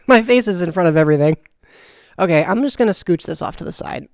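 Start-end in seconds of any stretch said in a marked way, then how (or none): none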